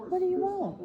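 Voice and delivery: high, coaxing pet voice; sing-song dog talk. Real, not acted. Owner coaxing her dog Charlie, high baby talk.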